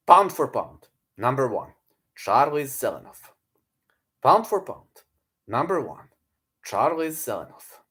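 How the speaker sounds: lightly hyped announcer call; mock ring announcer. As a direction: announce him like a champion.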